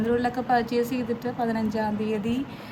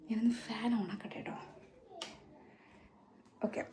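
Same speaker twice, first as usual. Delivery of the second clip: whisper, much softer, just an aside; 14 dB quieter than usual. Real, not acted. Vlogger telling viewers she'll switch the fan on, whispering. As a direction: drop to a whisper off mic.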